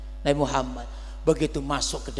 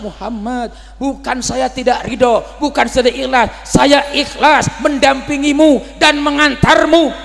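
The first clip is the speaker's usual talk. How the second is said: berseru tinggi dan keras; seruan keras; 12 semitones higher, 12 dB louder than usual. Acted. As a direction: teriakkan pembelaan, nada tinggi. Dialogue shouted between Nabi, Abu Bakar. (Abu Bakar membela diri, berseru tinggi dan keras)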